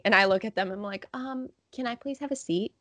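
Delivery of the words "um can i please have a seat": softly, meekly ask for a favor; small, quiet pleading voice; meek request. Retold or imitated. Kid asking parents for a seat, meekly.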